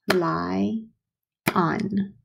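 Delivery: slow, split into two beats; lie... on, stretched apart; syllable by syllable. Shown as a demonstration. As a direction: sound out each syllable. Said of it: "Two syllables".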